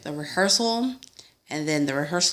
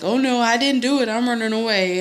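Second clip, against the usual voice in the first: mocking the guests; 7 semitones higher, 6 dB louder than usual. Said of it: "they were like".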